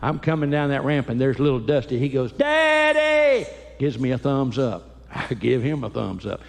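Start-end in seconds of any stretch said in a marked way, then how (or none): 2.3-3.4 put-on high, loud kid calling out; excited child shout. Little son shouting daddy at the airport, high and loud. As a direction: shout for daddy across the ramp.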